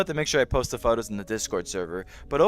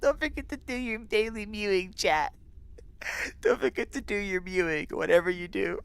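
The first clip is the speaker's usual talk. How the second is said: mock reminder voice; 8 semitones higher, 5 dB quieter than usual.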